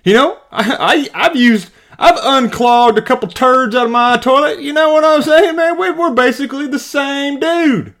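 high, eager voice of a clueless braggart; mock chummy amateur. Customer boasting to a plumber about toilets, mock chummy voice. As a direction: pitch it up, imitating someone claiming kinship. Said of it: "to a plumber and you're like".

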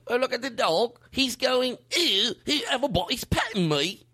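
high, hammed-up Cockney dog. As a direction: voice a dog's thoughts in bad Cockney. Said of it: "doing the eternal voice for the do".

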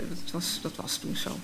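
breathless whisper, almost no voice; hushed whisper. Acted. Girl reading her diary whispers the candlelit Christmas memory. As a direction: whisper it as if the memory is too fragile.

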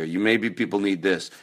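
normal talking voice

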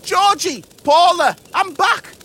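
high, loud call to the family. Acted. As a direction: shout home to the sisters. Beetle calls out to family.

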